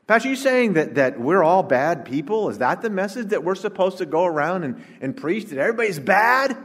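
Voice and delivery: mock outrage, high-pitched questioning; incredulous objector. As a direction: act out an appalled doubter, pitch pushed well up.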